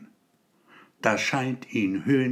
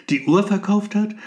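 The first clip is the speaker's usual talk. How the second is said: high, disbelieving, asking; stunned disbelief, voice rising; incredulous question; 9 semitones higher, 5 dB louder than usual. A narrator's reading. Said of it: "in ungläubig fragendem Tonfall".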